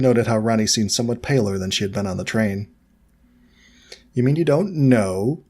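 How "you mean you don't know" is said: slow, drawn-out taunt; drawl. Acted. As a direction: draw the words out slowly to mock them.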